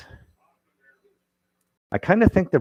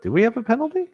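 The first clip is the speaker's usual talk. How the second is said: surprised; 5 dB louder, much faster than usual. Real, not acted. Confused about a call.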